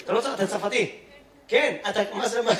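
normal talking voice

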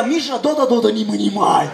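put-on a touch of Russian accent, firm; firm Russian mother calling her boy; Russian mom. Russian mother at the playground calling her son, firm with a touch of accent. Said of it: "אמא רוסיה".

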